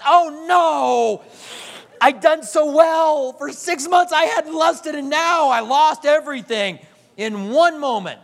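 loud, high, hammed-up cry of dismay; mock despair. Preacher hams up despair over a billboard, loud and high. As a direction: wail dramatically over a lost salvation.

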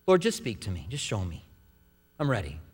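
hushed, low plea to God; humble prayer. Acted. Believer asking the Lord to speak, quietly humble. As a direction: drop into a quiet, willing prayer.

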